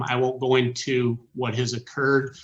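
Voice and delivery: ordinary talk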